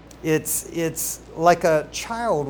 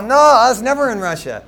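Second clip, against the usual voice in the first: a hint of a hard Russian accent, louder; 5 semitones higher, 9 dB louder than usual. Preacher imitating a Russian man denying he's from Russia, hint of Russian accent. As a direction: lightly put on a Russian accent, loud denial. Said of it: "they got this hard Russian".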